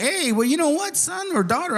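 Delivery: high-pitched, eager parent voice; parent engaging a child. Acted. Parent answering a kid about science, eagerly engaging.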